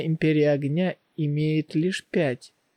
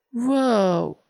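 amazed wow; 6 semitones higher than usual. Acted. Amazed at silver light.

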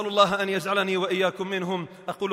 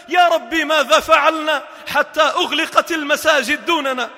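loud, high pleading sermon; fervent loud preaching; impassioned preaching; 7 semitones higher, 6 dB louder than usual. Acted. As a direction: plead from the pulpit. Preaching at full force.

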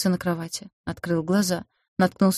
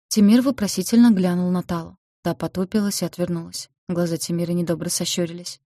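plain reading voice

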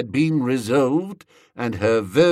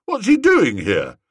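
suspicious; 7 semitones higher, 5 dB louder than usual. Acted. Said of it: "asked Gussie suspiciously".

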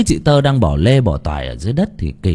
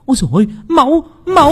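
high, panicked cry at the sight of blood; terrified cry; 4 dB louder, much faster than usual. A narrator's reading. Woman falling to the ground at dripping blood, terrified cry. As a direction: shriek it out in fright, far higher. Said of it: "lăn quay ra đất mà kêu lên".

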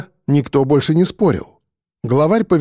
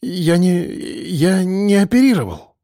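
slow, higher-pitched halting denial; stammering protest; 4 semitones higher, slower than usual. A narrator's reading. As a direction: stumble and restart, pleading a little. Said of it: "забормотал Евгений Петрович".

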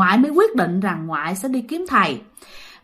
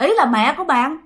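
ordinary delivery, nothing marked

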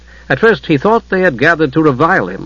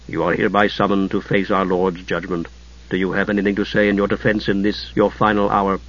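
low solemn tones; 5 semitones lower, 7 dB quieter than usual. A narrator's reading. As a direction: lower and softer, grave summons. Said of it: "speaking in low, solemn tones".

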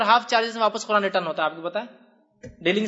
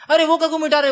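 raised, louder retold objection; mock protest; 9 semitones higher, 6 dB louder than usual. Customer objecting as the date gets wiped, in mock protest. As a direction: act out a startled customer's complaint.

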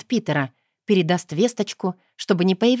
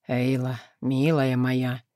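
low, slow and husky; husky sultry voice; 6 semitones lower, 3 dB quieter, slower than usual. A narrator's reading. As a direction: breathe it low with a rasp. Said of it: "с сексуальной хрипотцой голос".

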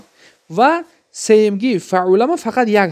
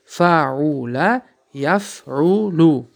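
each syllable drawn out very slowly; slow, deliberate teacher's enunciation; slow teaching drill; much slower than usual. Shown as a demonstration. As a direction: spell the pattern out slowly for students. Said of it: "آخرانگی رحمت بتین".

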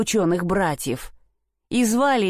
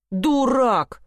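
higher, mildly scornful jibe; scornful brothers; 5 semitones higher than usual. A narrator's reading. Brothers dismiss the simpleton's find, scornfully. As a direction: toss out the insult as a storybook brother. Братья ругают Ганса.

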